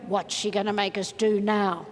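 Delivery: low, mock-reluctant grumble; grumbling doubter. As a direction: grumble it low, dreading the exercise. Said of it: "If anybody's going".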